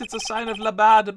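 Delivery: comic French accent on "la bad"; "la bad" in a hammed-up French accent. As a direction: roll out "la bad" like a French chef. [name]'s French-accented chef voice.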